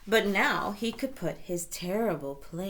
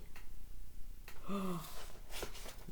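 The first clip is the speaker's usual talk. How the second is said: gasp; 8 dB quieter than usual. Acted. Wolf revealed.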